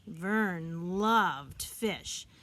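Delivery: ordinary delivery, nothing marked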